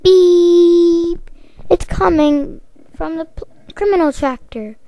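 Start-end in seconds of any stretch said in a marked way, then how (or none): 0.0-1.3 a high loud mouth-made alarm beep; voiced alarm beep. Criminal alarm sounding, high loud voiced beep.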